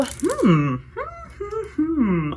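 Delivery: high, playful thinking hums; musing hums. Pretend. Vlogger pondering the palette before starting, playful musing hums. Pondering aloud what to make.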